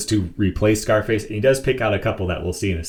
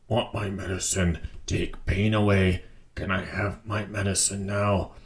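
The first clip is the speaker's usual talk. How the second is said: softer, pained begging; weak pleading; 6 dB quieter than usual. Acted. Amygdala softly begging Batman for medicine. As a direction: whimper for the medicine like a hurting brute.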